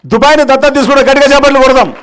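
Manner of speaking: rousing call to the crowd; rallying announcement. Real, not acted. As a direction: proclaim it and call for big applause. Announcing volunteer, calling for applause.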